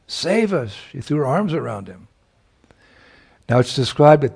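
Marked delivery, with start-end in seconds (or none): 0.1-0.7 high-pitched desperate plea. A narrator's reading. Radha begs the boatman, high-pitched and desperate. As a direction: plead with sudden height. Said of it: "like in desperation".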